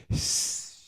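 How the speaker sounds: slow, hissing snake voice; drawn-out s like a snake. Acted. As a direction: hiss out the words slowly. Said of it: "motherfucking snake".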